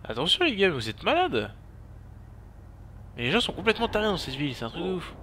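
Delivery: high-pitched, indignant outburst; alarmed indignation. Real, not acted. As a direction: protest high and fast at reckless people. S'indigne contre les autres conducteurs. (s'indigner, voix haut perchée)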